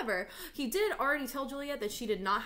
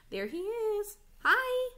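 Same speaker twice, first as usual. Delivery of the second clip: much higher, bright pet-talk voice; dog greeting; 13 semitones higher, 5 dB louder, slower than usual. Real, not acted. Teacher greeting her dog, in a much higher pet voice. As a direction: coo hello to the dog.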